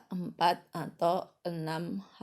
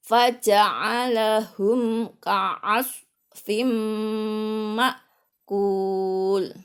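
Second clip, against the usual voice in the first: slow, loud chanted model verse; measured chant with drawn-out nasal hum; Quran recitation; 4 semitones higher, 8 dB louder, much slower than usual. Shown as a demonstration. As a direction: recite deliberately, holding the long syllables.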